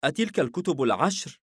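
eager, high-pitched question; thrilled, voice lifted high; excited. A narrator's reading. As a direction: ask it with rising excitement. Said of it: "ليفياثان بحماس".

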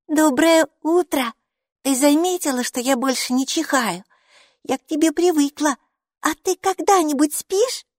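put-on high, bright child's voice, chattering; a cheerful little girl greeting her strange friend; little girl Maiki. Майки, маленькая девочка, радостно болтает с Букой.

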